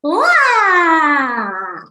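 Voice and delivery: long loud high playful roar; big open-jawed "waaah"; lion laughter. Shown as a demonstration. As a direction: pretend lion roar, falling slowly. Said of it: "looks and sounds like so".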